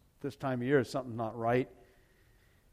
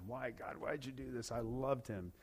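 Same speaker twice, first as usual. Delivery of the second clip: anguished plea to God; 9 dB quieter than usual. Retold or imitated. Angry at God after brother's death.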